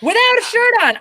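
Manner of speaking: high, emphatic echo; excited echo. Real, not acted.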